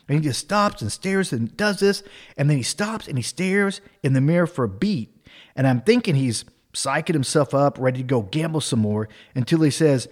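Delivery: ordinary delivery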